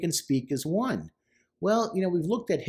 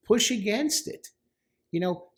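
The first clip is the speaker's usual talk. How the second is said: ordinary talk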